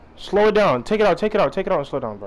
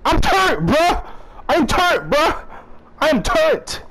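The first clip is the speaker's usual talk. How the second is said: much higher and louder, insistent; frustrated yell; 12 semitones higher, 3 dB louder than usual. Real, not acted. Gamer shouting at teammate to pass the ball, loud insistent yell. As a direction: yell it so the teammate finally passes.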